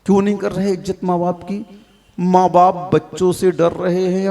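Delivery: usual speaking voice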